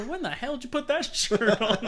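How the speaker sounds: high, laughing jeer at the shirt; amused heckle through a laugh; mocking heckler. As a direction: tease him like a rowdy fan. People mocking his shirt.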